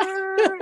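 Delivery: squeaky high yelp; high mock scream. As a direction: yelp like a startled guy. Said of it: "He's like".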